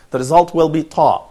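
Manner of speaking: usual speaking voice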